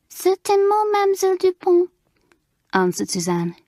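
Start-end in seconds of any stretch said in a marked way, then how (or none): 0.1-1.9 lightly voiced French schoolgirl with a French accent, demure; demure French girl. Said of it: "in a demure voice".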